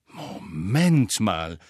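sehr langsam gedehnt, stutzend; plötzlich. A narrator's reading. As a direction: halt plötzlich inne und dehne es. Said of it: "sagte Hermine plötzlich". (plötzlich stutzend und gedehnt)

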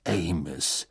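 understated, restrained anger; mildly angry warning. A narrator's reading. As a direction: rebuke a colleague with controlled displeasure. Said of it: "sagte Mister Weasley erzürnt".

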